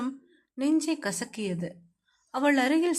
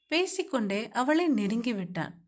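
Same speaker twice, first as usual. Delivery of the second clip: ordinary talk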